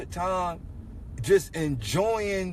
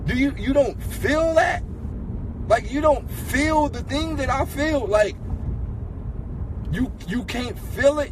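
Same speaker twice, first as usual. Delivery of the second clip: frustrated yelling; 6 semitones higher than usual. Retold or imitated. To his partner at the beach, rising frustrated yell. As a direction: demand she feel it too, voice climbing.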